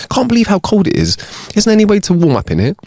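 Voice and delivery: lightly voiced lady, pitched high; high-pitched complaint about the cold; complaining lady. Acting complaining lady in joke.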